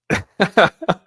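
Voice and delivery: loud high laugh. Real, not acted. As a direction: crack up at the chat. Streamer laughing at chat.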